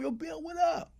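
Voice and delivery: ordinary talk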